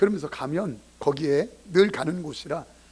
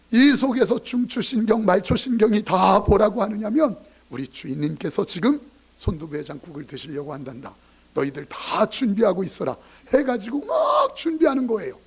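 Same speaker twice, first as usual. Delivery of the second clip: excited storytelling; 3 semitones higher, 4 dB louder than usual. Acted.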